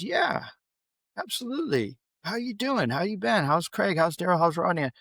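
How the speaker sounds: high-pitched delight, settling as the questions roll; retold happy catch-up, starting high; eager greeting. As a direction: greet an old friend eagerly. Eagerly greeting long-lost friend on call.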